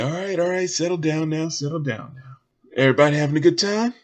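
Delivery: stage host hushing crowd. Acted. Host settling a cheering club audience, projecting showman. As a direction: work the crowd like an emcee. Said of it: "It's like".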